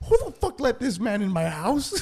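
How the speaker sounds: high-pitched startled demand; mock alarm. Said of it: "I'm like".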